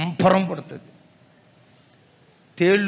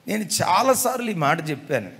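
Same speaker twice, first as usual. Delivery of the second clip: ordinary talk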